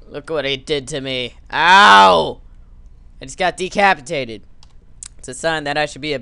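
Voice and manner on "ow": very loud, high yelp of pain; pained yell. Acted. Player face planting off the bike, pained yell. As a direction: holler in mock agony. Decapitated in game.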